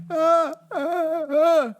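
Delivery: mock pained cries. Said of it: "be like".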